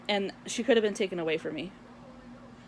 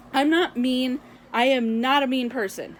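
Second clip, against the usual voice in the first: normal talking voice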